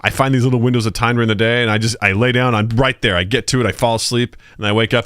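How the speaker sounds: normal talking voice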